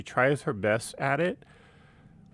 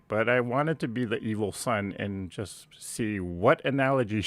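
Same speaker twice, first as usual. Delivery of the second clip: ordinary talk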